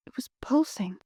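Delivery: whispering. Acted. Horror reveal, hushed.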